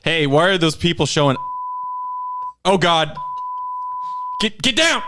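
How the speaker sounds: high alarmed yell; panicking as a raid starts; panicked shouting. Pretend. Acting out a police raid.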